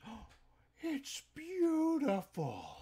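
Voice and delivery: high, slow, loud gushing voice; customer's voice. Retold or imitated. Buyer admiring the wrecked car, high gushing voice. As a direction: swoon over the junk car. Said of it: "He looks and goes".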